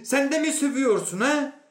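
high, loud confrontational question; outraged challenge. Acted. Inspector confronts the newcomer.

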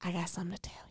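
confiding whisper to the manager; whispered secret. Retold or imitated. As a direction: lean in and whisper it. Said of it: "I'm like".

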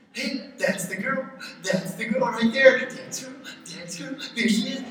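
a light sing-song coo, slowed a touch; cooing at the little girl; baby talk. Retold or imitated. Parent cooing at a baby, a light hint of baby talk. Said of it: "that's why you have baby talk".

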